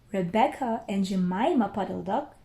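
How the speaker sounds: plain reading voice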